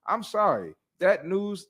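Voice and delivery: normal talking voice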